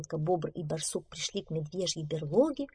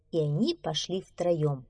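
plain reading voice

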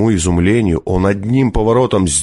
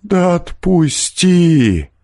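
drawn-out, higher plea to be let go; pleading; 10 semitones higher, much slower than usual. A narrator's reading. Captive peasant to the forester, pleading. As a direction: beg slowly to be released. Мужик просит отпустить.

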